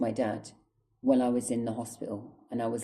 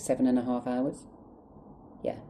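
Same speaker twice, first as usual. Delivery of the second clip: normal talking voice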